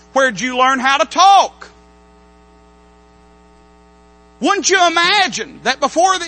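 loud, lively pulpit storytelling; preaching at full force. Acted. Preacher imagining Balaam's talk with his donkey, loud. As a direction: paint the scene with fervour.

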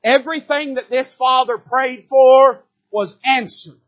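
loud, high, slow proclamation; preaching. Acted. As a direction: declare it slowly to the room. Preaching climax.

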